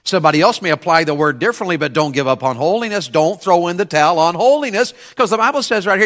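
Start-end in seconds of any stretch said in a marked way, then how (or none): none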